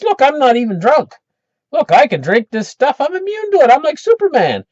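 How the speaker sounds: high-pitched, invincible little show-off; boastful kid. Retold or imitated. Jimmy tells watching kids he is immune, high boastful kid voice. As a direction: crow like a boy proving he's tough.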